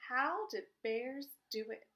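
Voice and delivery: ordinary delivery, nothing marked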